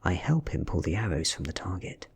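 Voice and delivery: plain reading voice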